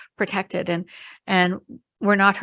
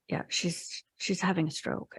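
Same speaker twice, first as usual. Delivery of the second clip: hushed confirmation; 9 dB quieter than usual. Retold or imitated. Friend confirms stroke, hushed.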